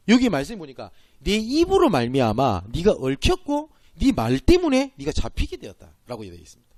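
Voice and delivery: ordinary talk, nothing marked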